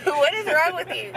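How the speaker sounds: high laughing question; laughing disbelief. Real, not acted. Laughing at husband's accent.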